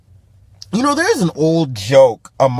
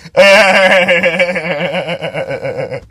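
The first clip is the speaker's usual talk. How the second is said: drawn-out rolling laugh; long laugh. Real, not acted. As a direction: laugh it out at your own jab. Laughs at own remark.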